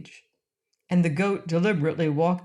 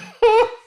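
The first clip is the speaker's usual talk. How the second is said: squealing high giggle; high jolly laugh; 9 dB louder than usual. A narrator's reading. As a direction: burst into a merry shriek of laughter. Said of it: "the jolly sounds of his laughter".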